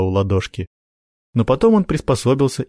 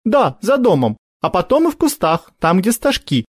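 put-on girl's voice; 10 semitones higher than usual.

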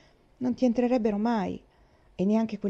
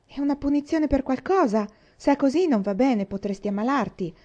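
worried; 6 semitones higher, 4 dB louder than usual. Acted. Jerry worried questioning.

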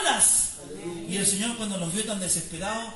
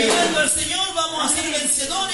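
preaching at full force; 3 semitones higher, 8 dB louder than usual. Acted. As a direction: preach it out to the congregation, almost singing. Preacher builds to climax.

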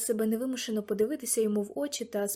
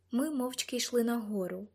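ordinary delivery, nothing marked

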